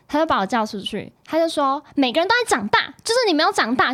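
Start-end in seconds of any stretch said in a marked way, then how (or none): 2.0-3.9 higher, louder retold scolding; angry scolding. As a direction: scold her like an angry friend. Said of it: "我闺蜜就超级生气的".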